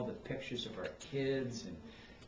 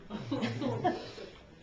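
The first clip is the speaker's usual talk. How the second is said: high teary laugh that breaks out; laughing through tears; 12 semitones higher than usual. Real, not acted. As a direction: laugh and cry at once. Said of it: "now I'm crying".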